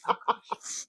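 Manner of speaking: ordinary talk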